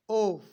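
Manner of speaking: "ऑफ" ending in a v sound, like "ov"; voiced v ending. Shown as a demonstration. Teacher models the v sound, "ऑफ" said as "ov". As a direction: say "ऑफ" loudly, closing on voiced v. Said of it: "अब इसको ऑफ नहीं".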